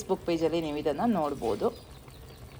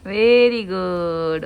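drawn-out praise; 6 dB louder, much slower than usual. Real, not acted. Mother praising daughter's smile.

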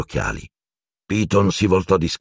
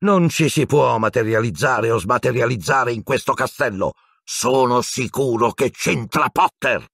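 higher-pitched, insistent anger; seething and certain, pitch raised; angry roar; 6 semitones higher than usual. A narrator's reading. Professor blaming Potter as he approaches, angry. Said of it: "ruggì Piton".

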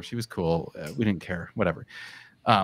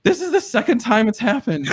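tearful neighbor; 11 semitones higher, 6 dB louder than usual. Retold or imitated. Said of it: "She's like".

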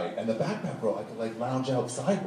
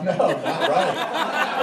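mock retort; 8 semitones higher, 5 dB louder, much slower than usual. Retold retort.

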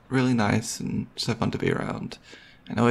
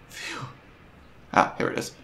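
ordinary talk, nothing marked

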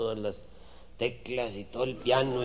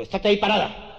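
firm command; 10 semitones higher, 10 dB louder than usual. Acted. Guy commands her.

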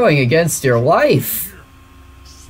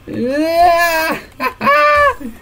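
high, squealing laughter; excited wailing laugh; 12 semitones higher than usual. Real, not acted. Overexcited urge to touch game figure.

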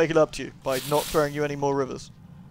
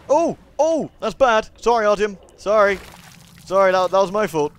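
higher, louder startled apologising; alarmed apology; 6 semitones higher, 8 dB louder than usual. Real, not acted. Player apologising to Artyom after a mishap, alarmed and loud. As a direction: blurt out alarm, then hurried apologies.

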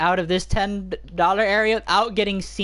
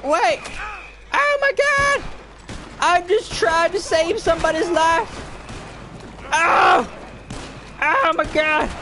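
panicked wail; 12 semitones higher, slower than usual. Real, not acted. Gamer chased for saving somebody's life, panicked wail.

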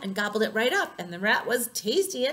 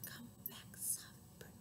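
barely audible whisper; soft; 24 dB quieter than usual. Shown as a demonstration. Calling the soft bird back, tiny whisper. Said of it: "One named Soft".

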